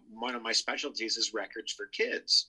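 ordinary delivery

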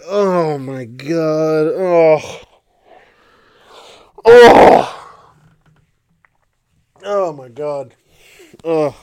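drawn-out exasperated groans, one bursting out loud; exasperated groaning. Retold or imitated. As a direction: groan it slowly, pained by a bad joke. Groaning at a lame joke.

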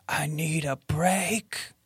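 whispered, slow ghost voice; ghostly whisper. Pretend. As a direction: breathe it like a ghost. Reading ghost answer options.

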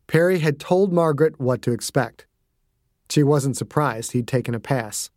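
ordinary delivery, nothing marked

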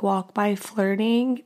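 ordinary talk

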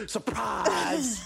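playful shout of Surprise; mock shout. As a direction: shout it as a reveal. Punchline reveal of hating the ad.

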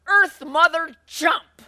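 high, slow, loud shout; shouted climax. A narrator's reading. Florence ends her outburst at family, shouting. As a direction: bellow the last words of the rant. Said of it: "Florence fumed".

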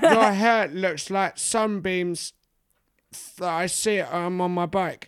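high, drawn-out parody love poem; mock bad poet. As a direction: stretch out each silly line like a clumsy poet. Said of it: "expecting it to just be like".

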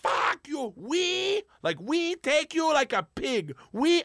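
put-on high-pitched, drawn-out Russian-accented taunt; yelling in Russian accent. Igor yelling at a cop on the platform, mock Russian-accented yell. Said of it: "starts yelling at him like".

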